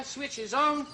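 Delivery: ordinary talk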